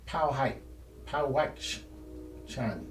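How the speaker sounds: Powhite, then Powhachchan drawn out slowly with a "chan" ending; alternative name pronunciation. Shown as a demonstration. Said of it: "It's another pronunciation of the same".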